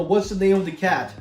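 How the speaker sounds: ordinary talk, nothing marked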